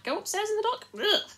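high, loud appalled squeal; high, loud mock horror; horrified shudder. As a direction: recoil at the very idea. Shudders at the dark.

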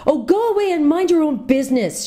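high, sharp burst of temper at Scrubb; fly off the handle, cutting him off mid-lecture. A narrator's reading. Jill turns on the interrupting boy, angry outburst. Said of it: "Jill suddenly flew into a temper".

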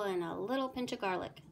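plain reading voice

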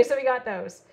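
ordinary delivery

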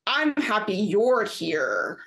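slow, stretched-out mock drawl; a slow smug drawl; long drawl. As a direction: drag each word out, imitating him. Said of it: "in, like, this long drawl".

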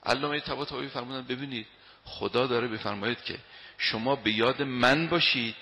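ordinary talk

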